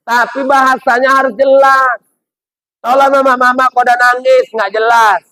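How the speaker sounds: emphatic shout. Real, not acted. Preacher answering emphatically.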